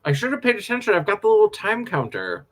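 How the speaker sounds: talking while chewing pizza; words through a mouthful of food; mouth full. Real, not acted. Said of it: "I'm trying not to talk with my mouth full".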